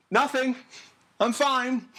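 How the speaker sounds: calling back to mom to cover up panic; loud forced reassurance. Retold or imitated. Denying to mom, shaken.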